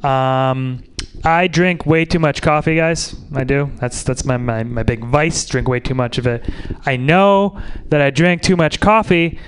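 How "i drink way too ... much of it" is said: usual speaking voice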